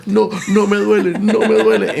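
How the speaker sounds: put-on high, loud wailing child; hammed-up brave sobbing; mock tearful denial. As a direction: imitate a boy crying while denying pain. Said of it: "del dolor que sentía".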